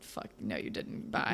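half-voiced mock mutter, Peter brushing off his dad; barely voiced, clipped brush-off; muttered under breath. Son dismissing his father, mock whispered retort. Peter's curt reply retold.

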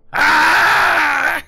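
sudden high, loud goblin shriek. Acted. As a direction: let out a startled screech as the shot whizzes past. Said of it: "and he goes".

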